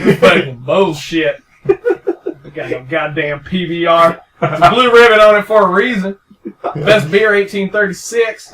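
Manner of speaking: put-on slow, loud, laughing drunk slur. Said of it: "That's my drunk voice".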